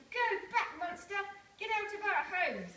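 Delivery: high, loud shout to drive it off. Acted. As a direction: yell the monster away. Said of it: "waved sticks and shouted".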